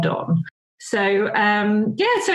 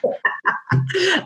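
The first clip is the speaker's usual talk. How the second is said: breathy embarrassed laugh at herself; sheepish laugh; self-conscious laugh; 8 semitones higher than usual. Real, not acted. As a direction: laugh at your own bad French. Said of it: "not laugh when I pronounce it".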